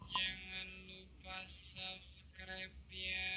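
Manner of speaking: slow, high, warped robot voice; a sluggish drunken robot. Shown as a demonstration. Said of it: "Atau robot mabuk".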